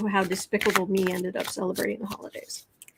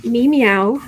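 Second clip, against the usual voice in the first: playful meow; 8 dB louder than usual. Real, not acted. Playful cat pun.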